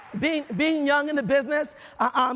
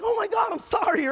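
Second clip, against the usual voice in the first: high, mock-embarrassed apology; flustered apology; 7 semitones higher than usual. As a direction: imitate someone mortified by their mistake. Guests mistook him for staff.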